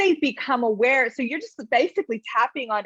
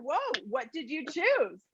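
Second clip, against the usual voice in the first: soft curious whoa; 3 semitones higher, 7 dB quieter, slower than usual. Retold or imitated. Acknowledging child.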